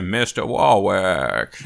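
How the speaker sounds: slow, lightly put-on Elmer Fudd voice in a Maine accent. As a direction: imitate the movie villain's odd drawling voice. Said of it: "he talked like this".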